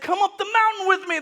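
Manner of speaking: high, loud summons; calling out. Acted. Moses inviting the people up the mountain, high loud call. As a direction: call up to a crowd.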